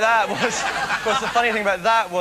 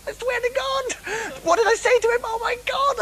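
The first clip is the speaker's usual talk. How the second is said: much higher, breathless excitement; excited. Real, not acted. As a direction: squeal it, still can't believe it. Excited recalling meeting Noel.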